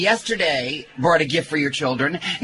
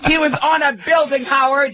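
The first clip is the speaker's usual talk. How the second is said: excited; 9 semitones higher, 3 dB louder than usual. Real, not acted. Excited guest telling story.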